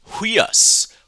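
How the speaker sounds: "Huios" high and loud, with a strong h breath at the start; rough breathing demo. Shown as a demonstration. Teacher shows the class the rough breathing on the Greek word. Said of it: "You see the rough breathing?".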